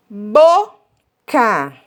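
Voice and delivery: "Boca" split bo, ca with a long pause; slow, separated syllables on "Boca". Shown as a demonstration. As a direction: sound out Boca in two separate beats. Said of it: "ela só tem dois pedacinhos".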